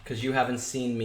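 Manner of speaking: ordinary delivery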